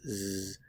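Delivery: final S of He's voiced as Z; Z said alone, quietly; Z sound. Shown as a demonstration. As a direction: buzz the Z. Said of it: "Notice the pronunciation of the S".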